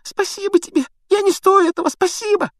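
very high, tearful squeal; Pettigrew moaning. A narrator's reading. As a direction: grovel in squeaky whimpering thanks. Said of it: "простонал Петтигрю". (тонкие плаксивые благодарности)

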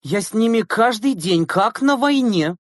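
high, emphatic, long-suffering; aggrieved complaint. A narrator's reading. Teacher complaining to the driver about pupils, exasperated.

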